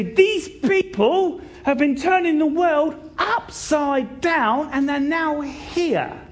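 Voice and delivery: high, slow, ringing denunciation; mob's accusation. Acted. Mob accusing the believers of causing trouble, high drawn-out denunciation. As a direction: proclaim it high, stretching the key words.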